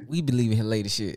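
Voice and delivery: ordinary delivery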